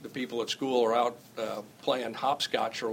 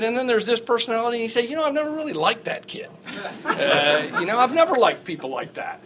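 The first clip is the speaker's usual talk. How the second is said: laughing quote; 9 semitones higher, 5 dB louder than usual. Retold or imitated.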